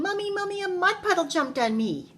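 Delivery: high-pitched cry for Mommy; high child's complaint, called out; yelling. A narrator's reading. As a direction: yell it high, sliding down at the end.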